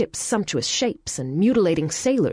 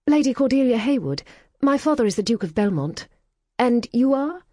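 higher, louder grand introduction; proud English lady; 7 semitones higher, 3 dB louder than usual. Acted. Cordelia introducing herself to the American, proud. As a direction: announce your rank. Cordelia announces her rank.

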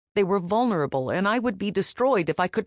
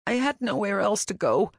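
sniffling; 5 semitones higher than usual. Acted. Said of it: "I sniffled".

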